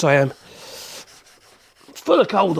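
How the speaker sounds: ordinary talk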